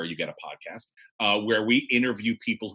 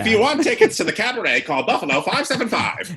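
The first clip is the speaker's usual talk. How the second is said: high, fast vintage radio pitchman; old-timey ad; 10 semitones higher, 5 dB louder than usual. Pretend. Spoofing a fifties radio ad for the cabaret, high and brisk.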